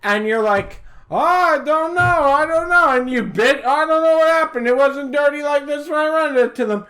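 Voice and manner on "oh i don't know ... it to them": put-on high, fast whiny old landlord protesting innocence; mock landlord voice. Mocking a Greek landlord who blames tenants, in a high whiny voice. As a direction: play the innocent landlord, pitched up. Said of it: "That was pretty close to an Italian accent".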